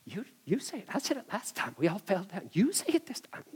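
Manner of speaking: hushed, frightened passing of the buck; soft, jittery mock panic, rising at the end; nervous bickering. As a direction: whisper urgently, pushing someone else to answer. Soldiers nervously pass on the answer.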